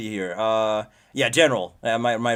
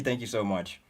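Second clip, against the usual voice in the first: ordinary talk, nothing marked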